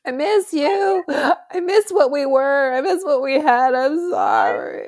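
high, slow, hammed-up weepy plea; fake-cry the apology over the phone; mock sobbing. Co-host playing the crying ex-bird on the phone, mock sobbing. Ex answering drunk call, sobbing.

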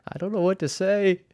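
high, loud, tearful retelling of her words; imitating a teacher choked up with happy tears; retold crying. Honored teacher thanking the school through tears, tearful. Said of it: "but she was crying".

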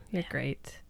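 whispered praise. Pretend. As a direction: breathe it softly.

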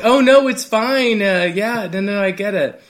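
high, drawn-out fake-polite brush-off; put-on reassurance. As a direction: act the too-accommodating employee. Retold over-polite reply to a customer.

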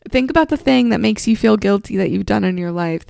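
ordinary delivery

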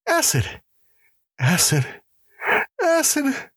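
high, terrified gasps with pauses between; gasped in terror. Acted. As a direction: gasp each word in high-pitched dread. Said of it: "gasped the prisoner".

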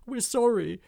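high, loud panicked apology; frightened cry. Acted. Goblin and tree caught by the dwarf, crying out high in fright. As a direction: yelp it out in fright. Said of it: "cried Hobgob and Mulch".

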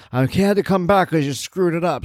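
put-on high, querulous old man voice; cranky old man.

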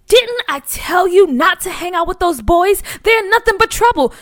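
high, loud reproach; scolding. Acted. Mother scolding her son about those boys. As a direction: tell him off sharply.

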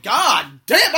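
loud, high burst of joy; elated exclamation. Retold or imitated. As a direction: exclaim it like you just got hired. Acted elation at getting job.

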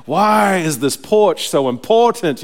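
griping at the task, drawn out; exasperated gripe. Retold or imitated. Digger complains to himself in the trench, exasperated.

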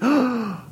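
sharp high gasp of alarm. Retold or imitated. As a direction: gasp as if shocked. Joshua alarmed at prophets.

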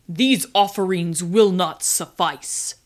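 loud, booming otherworldly voice. A narrator's reading. Voice from the toilet rejects offerings, booming. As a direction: boom it out like a demanding spirit. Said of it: "boomed a voice".

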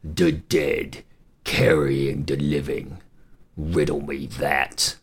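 low, slow third-head voice; many-headed hydra. Acted. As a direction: drawl the riddle low and slow. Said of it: "the third head asked".

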